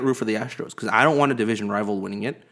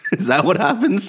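laughing; 9 semitones higher, 5 dB louder than usual. Real, not acted. Teasing about crying blood.

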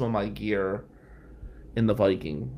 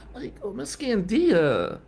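slow, high, breathy awe; man honoring a beloved teacher; reverent whisper; 10 semitones higher, slower than usual. Real, not acted. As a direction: hush in admiration. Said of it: "reverent tribute".

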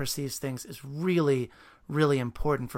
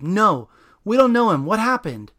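loud, higher yell; 6 semitones higher, 10 dB louder than usual. Acted. Dan yelling up to the super, loud. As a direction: call it up to the fire escape. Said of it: "Dan yells up at the fire escape".

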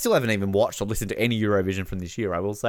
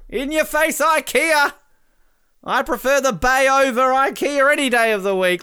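high, loud, triumphant taunt; gloating celebration; 13 semitones higher, 5 dB louder than usual. Real, not acted. Canada fan taunting Sweden after the miss, gloating. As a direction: crow over the rival, voice still up high.

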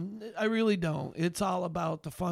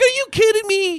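very loud, high shout of disbelief; retelling his own flabbergasted outburst; incredulous; 14 dB louder than usual. Retold or imitated. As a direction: shout it like you can't believe your ears. Acts out his disbelieving reply.

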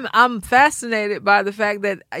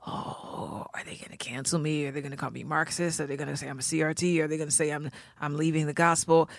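hushed worried whisper; 3 semitones lower, 11 dB quieter, faster than usual. Retold or imitated. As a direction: whisper anxious questions to yourself. Anxious inner voice, whispered.

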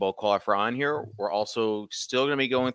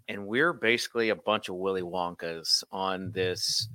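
ordinary delivery

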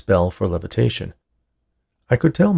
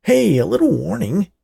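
complaining; 12 semitones higher, 4 dB louder than usual. Acted. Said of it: "Hal complained".

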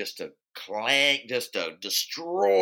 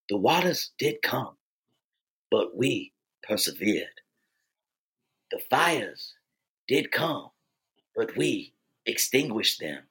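Morgan Freeman; 4 semitones lower than usual. Acted. Rallying the humans left on the world, mock-solemn rousing speech. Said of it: "Morgan Freeman gives this rousing speech".

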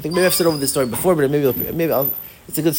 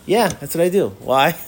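usual speaking voice

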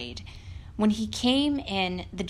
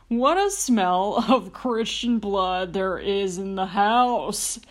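a lightly growled suspicious giant, a bit louder; 4 dB louder than usual. Acted. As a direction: sniff out the intruder like a giant.

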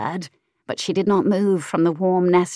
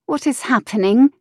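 a hint of a sleepy young woman, higher; sleepy puzzled mistress, pitched up; lightly voiced drowsy mistress; 6 semitones higher, 4 dB louder than usual. Mistress woken by the earl, sleepy puzzled voice.

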